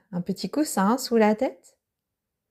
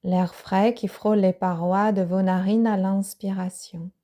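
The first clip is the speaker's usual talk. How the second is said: ordinary delivery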